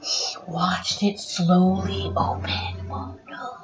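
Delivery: whispered and slow; suspense whisper. A narrator's reading. Reading to a child at the tense moment, whispering. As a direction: hush it to build suspense.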